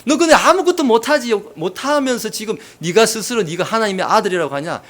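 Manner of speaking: high, loud jeering challenge; acted derision; mock taunting. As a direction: goad him as a scornful onlooker would. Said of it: "비아냥된다는 것입니다".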